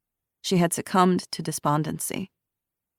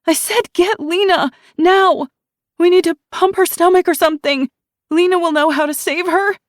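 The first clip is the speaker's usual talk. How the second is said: much higher, louder frantic yell; voice rising an octave; 13 semitones higher, 8 dB louder than usual. A narrator's reading. Ruby to Shiloh, frantic high yell. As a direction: scream orders in panic. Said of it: "Ruby's voice rose an octave".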